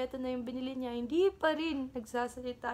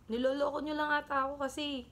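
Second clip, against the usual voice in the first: angry accusation, higher and louder; carried-away anger; 5 semitones higher, 4 dB louder than usual. Acted. Woman storming the pet shop, accusing the owner angrily. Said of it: "Nadala, nadala".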